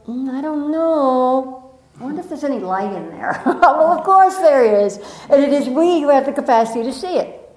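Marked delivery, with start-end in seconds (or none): 0.1-3.4 doubtful. Retold or imitated. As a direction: size someone up with doubt. Doubting someone's inner light.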